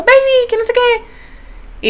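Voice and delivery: high, slow sweet-talking voice; stretched, lovey-dovey high imitation; mimicking Tony. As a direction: coo it like a lovestruck boyfriend. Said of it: "así todo".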